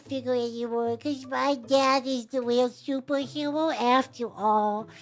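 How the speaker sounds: high, slow mock child voice; a sappy little boy; whiny kid voice. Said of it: "the boy that receives the toy is like".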